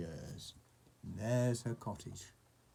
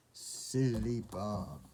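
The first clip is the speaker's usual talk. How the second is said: ordinary delivery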